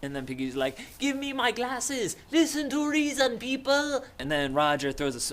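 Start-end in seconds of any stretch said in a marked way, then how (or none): 1.0-4.1 high, put-on whining demand; Piggy. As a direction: plead shrilly like an exasperated pedant. Piggy pleading, acted quarrel.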